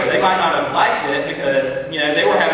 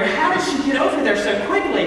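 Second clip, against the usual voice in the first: higher amazed question; 8 semitones higher than usual. Retold or imitated. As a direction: wonder aloud in disbelief. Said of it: "I'm wondering".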